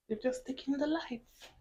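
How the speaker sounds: softly, almost whispering; real half-whispered aside; hushed near-whisper. Real, not acted. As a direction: drop to a hushed voice, as if whispering without meaning to. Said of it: "I don't know why I'm whispering".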